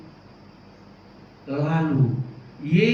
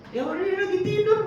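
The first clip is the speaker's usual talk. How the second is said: mock answer; 3 dB louder than usual. Pretend answer at funeral.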